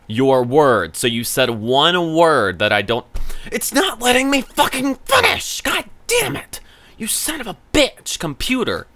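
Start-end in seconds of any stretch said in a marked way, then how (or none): 3.5-8.8 very high, strained furious cry; enraged shriek. Real, not acted. Chatbot keeps cutting him off, he shrieks in fury.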